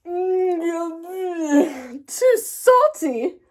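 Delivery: drawn-out, high, far voice on too salty; a disgusted, slow wail at the salty taste; pained cry. A narrator's reading. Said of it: "cried Missus Hagenmuller".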